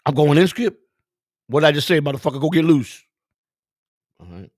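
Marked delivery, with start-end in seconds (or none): 0.1-0.8 raised, louder hopeful question; eager player. Retold or imitated. Benched player hopeful to play. 1.5-3.0 loud, higher retold order; impatient manager. Manager snaps at player.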